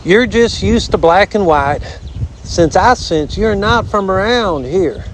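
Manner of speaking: high, drawling southern armadillo; southern storybook critter; folksy armadillo. Acted.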